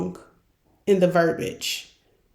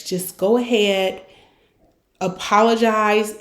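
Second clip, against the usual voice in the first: ordinary talk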